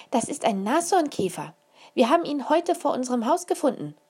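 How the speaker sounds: put-on proud little girl. A narrator's reading. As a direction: announce it proudly like a small girl. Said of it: "verkündete Isa stolz".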